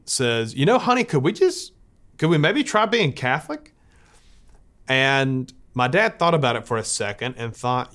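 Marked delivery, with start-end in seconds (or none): 0.5-3.7 high, pleading mock plea to her husband; desperate plea. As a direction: coax the husband gently, a bit desperate. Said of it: "in a moment of desperation".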